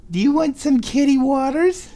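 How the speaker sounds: high, lilting offer. Real, not acted. Owner offering water to her cat in high baby talk. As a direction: coax the kitty sweetly.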